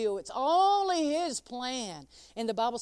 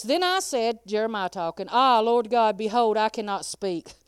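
preaching scripture; 7 dB louder than usual. Acted.

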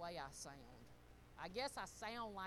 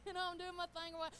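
ordinary talk

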